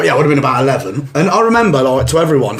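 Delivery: ordinary delivery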